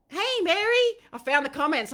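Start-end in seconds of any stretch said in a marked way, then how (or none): 0.1-0.9 high, loud happy hello; bright high shout of greeting; excited greeting. Real, not acted. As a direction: call out to a friend you're thrilled to see. Greeting a viewer on the live.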